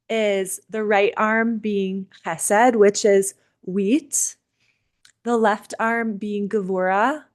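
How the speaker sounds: slow, spaced-out listing with pauses; slow recital. Real, not acted. Host repeating the seven fruits for a note-taker, slowly. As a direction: list each item slowly, pausing between them. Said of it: "could you say it again slower".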